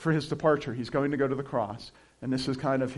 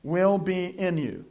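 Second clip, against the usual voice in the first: "will be in you" slow and deliberate; emphasized phrase; 3 semitones higher, slower than usual. Shown as a demonstration. Pastor highlighting an important phrase, slow stress. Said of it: "here's an important phrase".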